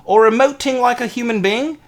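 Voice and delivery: mock-official terms in exaggerated Australian; amateur Australian accent. Fake ad laying down walrus rules to a housemate, mock Australian accent. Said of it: "that's all I have for Australian".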